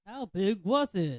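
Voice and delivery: loud, high, drawn-out question; feed the boaster his cue, loud and slow; playing-along straight man. Playing along with fish tale.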